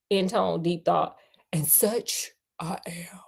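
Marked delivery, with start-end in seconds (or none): 1.5-3.2 slow unvoiced whisper. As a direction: let the computer breathe it out slowly. Computer's line whispered.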